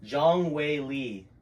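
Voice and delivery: slow, exaggerated mouthing; over-articulated. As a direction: spell it out with big lip movements. Mouthing names for lip reading.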